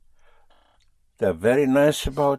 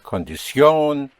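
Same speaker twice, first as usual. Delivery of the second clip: Condición slow, with the final ON held and pushed; Condición as con-di-SYON, slowly; stress on the ending; 3 semitones higher, 4 dB louder, slower than usual. Shown as a demonstration. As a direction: hit the last syllable of Condición. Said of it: "find an accent over the O".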